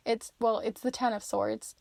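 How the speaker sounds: usual speaking voice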